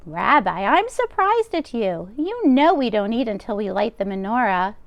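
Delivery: bustle like a kind deaf baba; old grandmother voice. Acted. Baba voicing lines to Bear.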